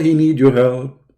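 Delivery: ordinary delivery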